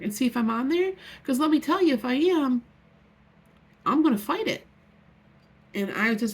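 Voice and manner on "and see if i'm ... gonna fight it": louder, higher angry threat; angry retold warning, raised voice. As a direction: warn her off, fired up. Threatening the photographer.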